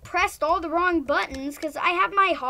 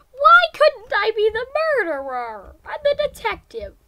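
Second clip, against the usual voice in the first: whiny complaint; 7 semitones higher, 10 dB louder than usual. Real, not acted. Complaining about role in game.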